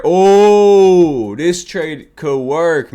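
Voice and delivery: thrilled loud realization the trade works; excited discovery. Real, not acted. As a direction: shout it with sudden delight.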